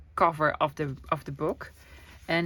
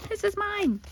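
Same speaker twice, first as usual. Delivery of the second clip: much higher, teasing claim; playful possessive squeal; 13 semitones higher than usual. Shown as a demonstration. Vlogger teasing viewers she keeps the fabric, high squeal. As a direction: squeal it like a kid hugging a toy. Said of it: "I'm sorry, guys".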